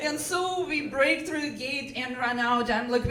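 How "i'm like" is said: ordinary talk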